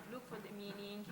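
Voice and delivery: usual speaking voice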